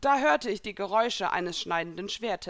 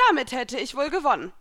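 triumphant, louder and higher; confident of victory; 5 semitones higher, 6 dB louder than usual. A narrator's reading. Elsa to her falling opponent, sure of victory. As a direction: declare it like a sure winner. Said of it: "sagte Elsa siegessicher".

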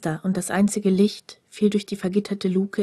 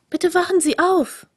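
much higher and louder, insistent; urgent waking call; 9 semitones higher, 4 dB louder than usual. A narrator's reading. Librarian wakes the dozing man, higher and urgent. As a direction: call a sleeper awake, urgently.

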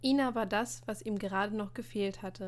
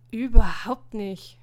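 loud, slow, emphatic protest; indignant denial; 16 dB louder, slower than usual. A narrator's reading. Martin denies to Emil being jealous, loud and indignant. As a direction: deny it loudly, stretching the words.

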